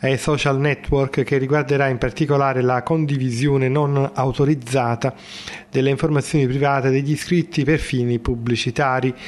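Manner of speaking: measured radio newsreader delivery; news read. Acted. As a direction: read it like a formal bulletin.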